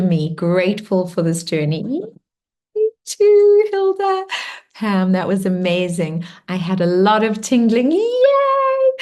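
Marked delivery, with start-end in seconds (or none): 1.8-4.3 much higher, squealed reply; excited. Real, not acted. Replying joyfully to a comment. 7.9-8.9 much higher squeal of joy; excited cheer. Real, not acted. Cheering a participant's comment.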